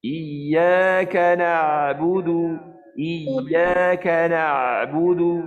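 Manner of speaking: slow chant, throat narrowing but opening on the ain of "na'budu"; correct recitation. Shown as a demonstration. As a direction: recite "na'budu" with the ain half closed then opened. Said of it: "dia gaya nak rapat, dia buka".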